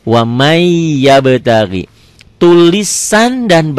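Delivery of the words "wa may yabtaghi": slow "Wa may yabtaghi", the y held long; measured recitation demo, "may" stretched into "yabtaghi"; idgham with ghunnah. Shown as a demonstration. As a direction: sustain a hum on the end of "may" before "yabtaghi". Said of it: "Idgham bigunnah".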